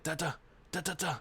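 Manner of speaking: lightly voiced mock gibberish; mocking babble. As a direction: babble it like a taunt. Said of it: "He said".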